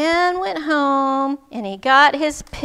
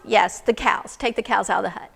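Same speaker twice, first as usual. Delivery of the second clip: lower, matter-of-fact advice; calm instruction; 4 semitones lower than usual. Acted. Wise woman instructing the man, calm. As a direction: answer evenly and firmly.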